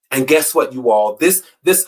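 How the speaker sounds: ordinary talk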